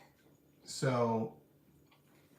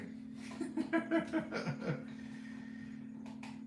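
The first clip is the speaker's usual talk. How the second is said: silent laugh; 9 dB quieter than usual. Real, not acted. Laughing about pillow in back.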